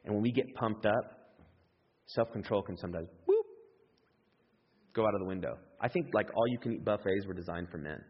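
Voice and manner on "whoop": quick high whoop sound effect; imitated whoop. Shown as a demonstration. Preacher imitating self-control going out the window, quick whoop. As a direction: toss out a short whooshing whoop.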